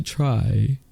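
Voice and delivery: slow, deliberate pacing around retainers; careful, drawn-out delivery; speaking slowly. Real, not acted. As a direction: take it slowly, word by word. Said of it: "speak slowly".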